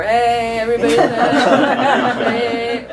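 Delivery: loud, laughing hearty greeting, stretched out slowly; jolly dad. Retold or imitated. Father coming home to greet the family, loud and laughing. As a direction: boom it out like the fun dad home from work. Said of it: "the father would come home".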